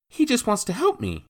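put-on high young boy's voice; a small boy begging; pleading boy. Boy to his stepfather, high pleading boy.

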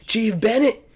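high-pitched mock holler with a Southern accent; high Southern-accented yell, half volume; imitated yelling. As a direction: imitate a recruit yelling on command. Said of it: "just yelling".

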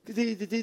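soft, high "ti ti ti" mimicking a ringtone; a hushed ringtone impression; phone ringing imitation. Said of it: "que tava tocando o celular".

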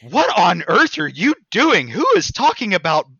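mother bursting in on her son's game; scandalized mom. Retold or imitated. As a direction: demand it like a shocked mom. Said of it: "Mom in the background going".

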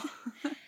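short nervous giggle; a quick uneasy laugh. Real, not acted. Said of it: "That, that nervous laugh".

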